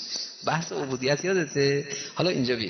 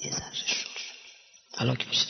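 whisper; 4 semitones lower, 8 dB quieter than usual. Real, not acted. Aside on the weak-willed.

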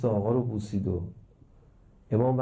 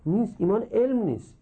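نیست said high and firm; high emphatic preacher no; emphatic denial; 10 semitones higher than usual. Real, not acted. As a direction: answer your own question with force. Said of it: "ایمان علم هست یا نیست؟".

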